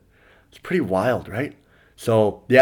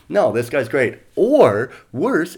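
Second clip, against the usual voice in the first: ordinary delivery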